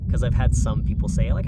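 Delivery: talking over roaring jets; over jet engine noise. Real, not acted. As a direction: speak through a loud jet flyover. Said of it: "we got jets flying over".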